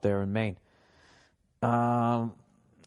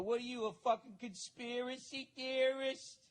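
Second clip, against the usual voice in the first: high, put-on scoffing voice, quieter; mocking sneer; 11 semitones higher, 6 dB quieter than usual. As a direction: sneer at him like he's a nutcase. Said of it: "and then they'll just be like".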